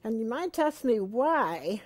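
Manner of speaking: ordinary talk, nothing marked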